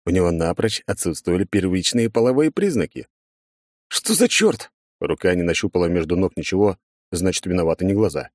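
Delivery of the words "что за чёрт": much higher, shocked outcry. Acted. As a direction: blurt it out in shock. Shocked at missing parts. (потрясённый возглас)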